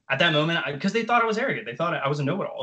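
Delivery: normal talking voice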